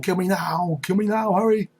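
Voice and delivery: high, urgent mock screaming. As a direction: play a madman screaming to be killed, without full volume. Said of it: "So he's screaming out".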